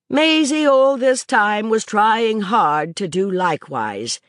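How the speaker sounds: plain reading voice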